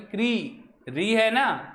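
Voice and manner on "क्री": क्री drawn out with a clear "ree"; "kri" sounded out. Shown as a demonstration. Teacher isolates how the syllable is read, careful pronunciation. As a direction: say क्री as one long "kree". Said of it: "क्या पढ़ेंगे?".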